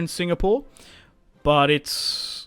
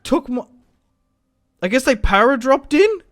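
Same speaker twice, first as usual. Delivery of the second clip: usual speaking voice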